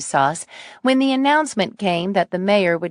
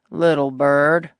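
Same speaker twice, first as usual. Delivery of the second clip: Texan drawl; 3 semitones lower, 3 dB louder, slower than usual. Acted. Rancher voiced.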